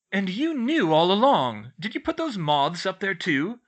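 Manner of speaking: loud, high accusation; demanding. A narrator's reading. George confronts Hermione about the moths, demanding. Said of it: "George demanded".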